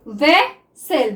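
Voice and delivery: Officer drawn out very slowly; teacher dictating officer to students; slow dictation. Shown as a demonstration. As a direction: stretch officer as if writing it down. Dictating while writing shorthand.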